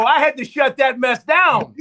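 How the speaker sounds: loud, fired-up declaration; emphatic shout. Real, not acted. Coach shouting to the host about shutting it down, loud.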